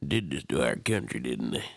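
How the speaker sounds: mock an angry old conservative; mock patriot. Mocking conservative rant.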